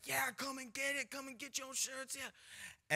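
high-pitched street vendor patter; shirt hawker. Retold or imitated. Big scary guy selling shirts to passers-by, hawking. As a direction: hawk your wares in a pushy high voice.

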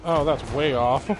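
normal talking voice

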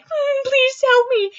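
hammed-up whimpering plea; mock pleading. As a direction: beg like a helpless kid being dragged away. Being marched off by devils.

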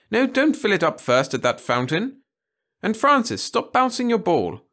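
high, loud, cheery schoolmistress; cheery call. A narrator's reading. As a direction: call out brightly to a group of children. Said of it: "called Miss Burton cheerily".

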